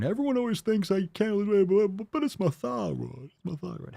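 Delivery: high whiny stammering but but but; mock whiny excuse. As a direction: mimic someone blaming their thyroid. Mocking a thyroid excuse.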